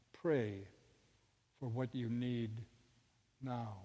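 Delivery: low, soft, with long pauses; calm inner voice. Retold or imitated. Inner voice repeating its instruction, low and calm. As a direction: give each phrase quietly and let it settle. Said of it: "The voice said one more time".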